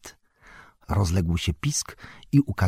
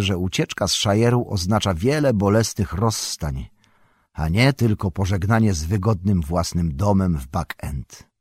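plain reading voice

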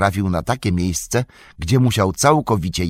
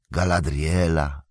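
slow, soft, sorrowful murmur of "Galadriela"; sad whisper; 4 semitones lower, 4 dB quieter than usual. A narrator's reading. Sam softly saying "Galadriela" to Frodo, sad and slow. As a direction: breathe "Galadriela" slowly and softly, full of loss. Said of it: "szepnął ze smutkiem".

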